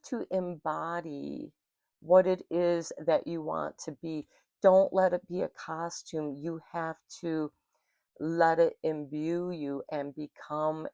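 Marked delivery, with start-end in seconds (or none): none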